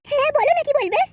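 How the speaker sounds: put-on coy young bride. Bride asking the matchmaker where to sit, high coy voice. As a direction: play the shy village girl.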